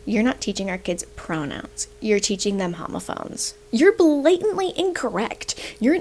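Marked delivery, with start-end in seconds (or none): 0.0-3.5 quoted teacher read much softer; hushed mocking quote; repeating the teacher's post in a soft dry voice. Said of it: "English teacher that said".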